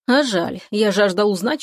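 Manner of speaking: ordinary delivery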